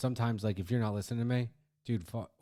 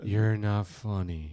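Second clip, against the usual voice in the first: very slow, exaggerated calm delivery; dad pretending to scold gently; mock calm; much slower than usual. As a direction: stretch it out soothingly, tongue in cheek. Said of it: "Very calm, very soothing".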